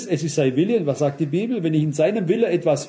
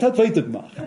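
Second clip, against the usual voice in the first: ordinary delivery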